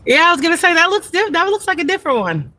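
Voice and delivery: bright high neighborly greeting voice; friendly high chat. Real, not acted. Greeting a neighbor walking his dog, high and friendly.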